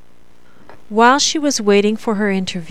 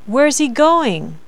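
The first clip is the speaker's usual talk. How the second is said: slow, stepping down within "going"; step down; 4 semitones higher than usual. Shown as a demonstration. Teacher demonstrating a step down, "going" dropping on its last syllable. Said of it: "like a step down in pitch".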